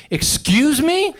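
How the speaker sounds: high, loud, drawn-out excuse me; disbelieving. Shown as a demonstration. Comic showing someone who heard but can't believe it, incredulous. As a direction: stretch it upward in offended disbelief. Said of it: "you can't believe they said it".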